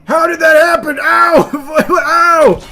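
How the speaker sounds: high, loud yelps of pain; pained shouting. Real, not acted. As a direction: yell out as if hurt. Character hit, pained reaction.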